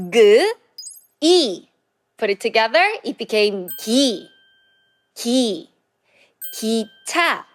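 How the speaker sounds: 기 built from G and E then 기차 said clearly; teacher sounding out 기차; Korean syllable demo. Shown as a demonstration. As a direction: blend G and E into 기, then say 기차. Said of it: "The first one is 기차".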